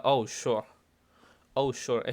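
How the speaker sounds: loud, falling "Oh, sure"; disbelieving agreement, leaning on Oh; sarcastic. Shown as a demonstration. Teacher showing a peculiar pronunciation, sarcastic. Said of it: "está siendo sarcástica la persona".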